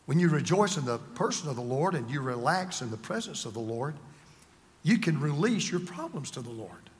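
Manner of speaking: low, softened voice; gentle reassurance. Real, not acted. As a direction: keep it low to soothe the listeners. Same comforting point, before repeating louder.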